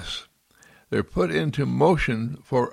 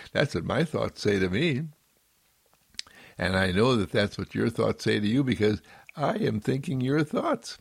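usual speaking voice

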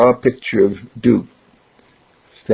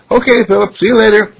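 put-on high, louder kids' goodbye; friends calling out; 12 semitones higher, 5 dB louder, faster than usual. Friends calling goodbye to Philip, high and bright. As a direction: call it out like kids parting.

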